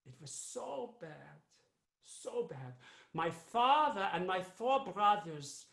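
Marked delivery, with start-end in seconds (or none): 0.1-2.7 hushed sorrow. Acted. Grim memory of persecution.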